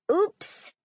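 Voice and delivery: high, playful oops; a light, joking little oops; jocular. A narrator's reading. Father agreeing his other daughter was overlooked, jocular. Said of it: "her father agreed jocularly".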